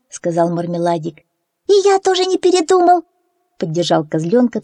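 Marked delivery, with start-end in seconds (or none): 1.7-3.0 very high, quick eager chick; peeping baby chick. A narrator's reading. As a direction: chirp it out eagerly. Chick Fyu joins in.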